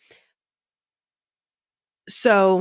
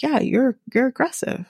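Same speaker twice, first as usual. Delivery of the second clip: higher, mildly snippy retold insistence; attitude-y insistence; 6 semitones higher than usual. Man doubling down on his accusation, retold with attitude. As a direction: insist with a little attitude, rising at the end. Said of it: "got a little attitude-y with her".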